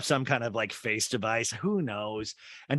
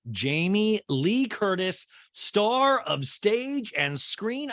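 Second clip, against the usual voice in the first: slow, higher, ceremonious build-up; grand introduction; 7 semitones higher, much slower than usual. Pretend. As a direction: present a star, lingering on each word.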